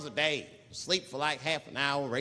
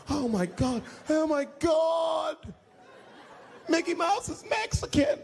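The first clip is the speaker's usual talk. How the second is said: high loud shocked shouts; slow shrieking panic; crowd screaming; 11 semitones higher, 4 dB louder, slower than usual. Retold or imitated. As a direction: scream it in disbelief. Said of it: "Everybody was screaming".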